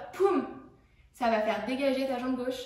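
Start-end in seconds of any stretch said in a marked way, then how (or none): 0.1-0.5 punchy high "Poum!" burst; sharp pop. Shown as a demonstration. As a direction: snap out "Poum!" like a hit on the beat. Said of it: "t'as un petit pop". (« Poum ! » sec, plus aigu et plus fort)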